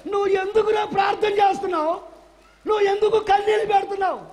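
preacher voicing God scolding Moses; God shouting. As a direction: shout it down from heaven. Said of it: "దేవుడు అంటాడు".

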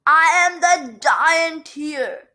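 angry, domineering bellow; shouting. A narrator's reading. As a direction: shout it to claim the place. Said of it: "he shouted".

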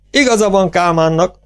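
high, undulating tenor cry; theatrical high-pitched protest; tenor shout. A narrator's reading. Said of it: "Gál leghullámosabb tenor kiáltása".

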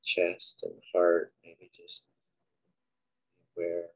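unhurried, quiet cues for breath and body; guided meditation. Acted. Leading listeners through breathing and a body scan, guided meditation.

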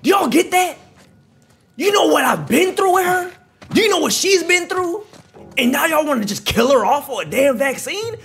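high, loud, indignant rant; furious shouting. Real, not acted. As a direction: yell it like a protective father. Raging protecting Ellie.

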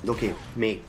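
usual speaking voice

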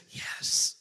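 put-on little boy's hushed whisper. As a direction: whisper secretly into the phone. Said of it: "came back on the phone whispering".

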